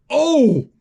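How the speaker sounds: a sharp high oh of alarm; excited exclamation. Real, not acted. Kid retelling the rope scene, crying out in alarm. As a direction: gasp as if watching it fall.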